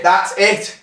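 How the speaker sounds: high, rising, pleased; excited. Real, not acted. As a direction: cheer the good shot. Repeated after a good shot.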